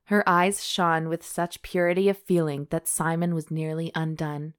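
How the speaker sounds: normal talking voice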